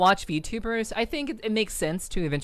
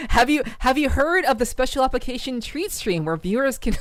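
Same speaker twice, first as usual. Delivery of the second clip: mock advertisement voice; 6 semitones higher than usual. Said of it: "worded it like it was, like, an advertisement".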